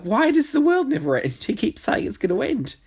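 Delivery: a hammed-up puzzled follower, high and loud; mock baffled follower. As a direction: play a bewildered cult follower asking why. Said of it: "these people must be like".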